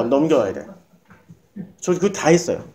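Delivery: usual speaking voice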